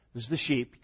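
ordinary talk

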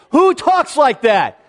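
exasperated and baffled, played for laughs; mock incredulity. Mock outrage at churchy phrase.

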